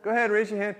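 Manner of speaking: normal talking voice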